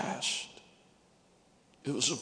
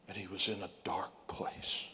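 whispered; 3 semitones lower, 6 dB quieter than usual. Acted. Preacher dropping to a hush.

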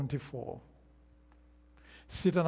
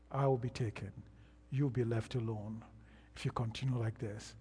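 softer, lower, grave warning from the pastor; solemn pastor's warning; 5 semitones lower, 6 dB quieter than usual. Retold or imitated. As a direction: lean in and warn him quietly of being left behind. Pastor warns of being left.

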